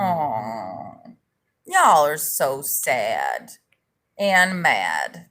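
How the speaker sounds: low, slow fake sympathy; mock pity. Streamer mocking upset viewers, fake pity. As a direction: coo at them in mock pity.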